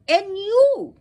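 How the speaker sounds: loud, outraged accusation; angry. Acted. Goddess turns on Vishnu for defending Brahma, angry. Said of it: "turned her angry eyes to Vishnu".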